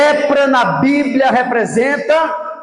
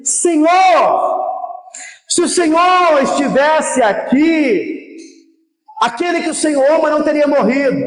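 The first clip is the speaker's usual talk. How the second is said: pleading outcry; 4 semitones higher than usual. Acted. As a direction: call out in pleading lament. Martha and Mary lament to Jesus.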